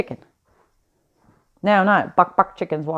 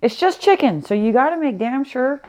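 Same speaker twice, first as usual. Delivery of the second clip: quoting grandma; 6 semitones higher, 3 dB louder than usual. Retold or imitated. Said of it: "That's what she said".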